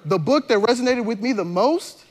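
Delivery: ordinary delivery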